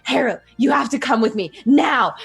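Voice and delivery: desperate, hurt mentor pleading; urgent plea. Acted. Wounded mentor to Harrow, urgent and shaken. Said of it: "drag you along".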